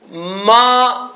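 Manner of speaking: normal talking voice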